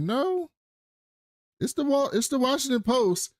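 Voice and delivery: mock high-pitched assistant correcting the boss; insistent assistant. Assistant tells the boss which paper called, insistent.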